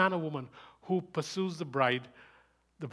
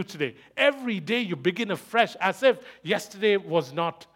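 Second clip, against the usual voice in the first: loud, high, emphatic sermon delivery; impassioned preaching; 5 semitones higher, 8 dB louder than usual. Real, not acted. Preacher urging listeners to start afresh every day, impassioned and loud. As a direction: drive each point home, voice raised.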